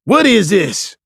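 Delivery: loud high angry demand; spitting mad at the detective. A narrator's reading. Cuffed Beach demanding answers from French, angry. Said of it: "Beach demanded".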